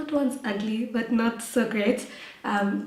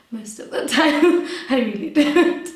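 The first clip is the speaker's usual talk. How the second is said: real laughter through the words, a bit louder; laughing admission; 5 semitones higher, 8 dB louder than usual. Real, not acted. Guest confessing life isn't together, laughing. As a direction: admit it while laughing.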